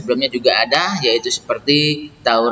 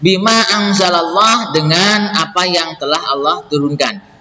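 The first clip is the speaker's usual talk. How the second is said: raised, slow, measured recital of verse and gloss; verse reading; 7 semitones higher, slower than usual. Acted. Teacher reading scripture and translation for students, slow measured recital. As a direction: read each phrase deliberately in a lifted voice.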